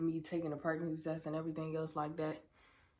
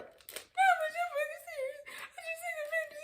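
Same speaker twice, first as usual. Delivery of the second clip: high, wailing cry through the words. Retold or imitated. Crying to her friend about the positive test. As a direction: sob the words out. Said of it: "I bust out crying".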